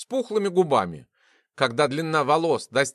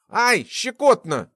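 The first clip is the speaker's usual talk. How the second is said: high, drawn-out squirming cry; ticklish complaint; 6 semitones higher, 4 dB louder, slower than usual. A narrator's reading. Test subject being prodded on the face, ticklish yelp. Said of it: "не унимался тот".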